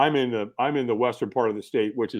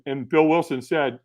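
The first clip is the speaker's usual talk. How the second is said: usual speaking voice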